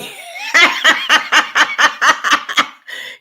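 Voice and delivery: breathless, airy laugh with no voice; wheezing laugh. Real, not acted. Reacting to chat comment.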